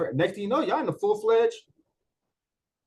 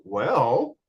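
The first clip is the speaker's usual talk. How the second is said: ordinary talk, nothing marked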